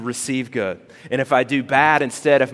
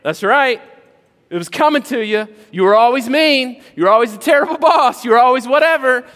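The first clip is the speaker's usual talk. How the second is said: high, loud mocking rant, faster near the end; petty gloating; 10 semitones higher, 6 dB louder, faster than usual. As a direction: gloat over a bad boss who fell. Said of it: "You're like".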